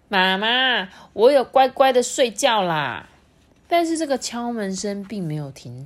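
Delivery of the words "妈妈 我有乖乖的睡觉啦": put-on calling out loud as a boy; loud child call. A narrator's reading. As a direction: shout it from the bedroom. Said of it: "小健大声的说". (大声喊的小孩)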